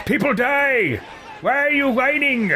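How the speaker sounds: high, shouted hammed-up scolding; scornful game character. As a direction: berate them in a shrill-pitched character voice. Plays his character scolding grieving ally.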